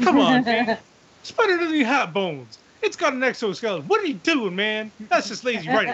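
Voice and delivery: high-pitched exasperated rant, imitated; losing it. Tyler complains about the boneless spider monster, high exasperated rant. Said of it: "Tyler loses his shit".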